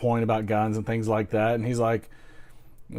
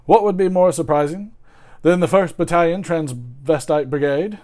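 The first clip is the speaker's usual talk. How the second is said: put-on loud, high British accent; Eddie Izzard; 6 semitones higher, 8 dB louder than usual. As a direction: proclaim it in a mock British comedian voice. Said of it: "my take on the British accent".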